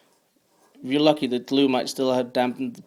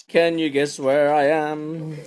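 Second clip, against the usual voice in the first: ordinary talk, nothing marked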